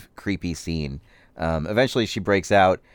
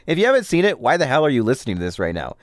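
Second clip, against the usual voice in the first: ordinary talk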